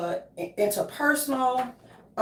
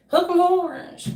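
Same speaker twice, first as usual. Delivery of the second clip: higher, louder drawn-out cheer; stretched team chant, pitched up; 8 semitones higher, 5 dB louder, slower than usual. Pretend. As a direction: belt out a slow rallying cry.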